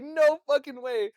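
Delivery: high, slow, emphatic mock yell; loud disbelief. Boy told the dad likes him, loud mock disbelief. Retells disbelief at friend's claim.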